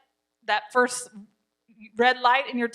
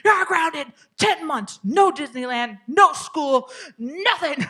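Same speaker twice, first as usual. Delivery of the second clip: loud, drawn-out punishment rant; mad mom; 5 semitones higher, 6 dB louder, slower than usual. Acted. Mad mom handing her kid over-the-top consequences, loud and exaggerated. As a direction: pile on each punishment in a mock-furious shout. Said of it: "just like that mom".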